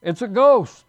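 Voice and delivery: high, loud cry of alarm; terrified cry. Acted. Pastor voicing the frightened disciples on the boat, a loud high cry. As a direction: shout it like sailors seeing a ghost. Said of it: "they cried".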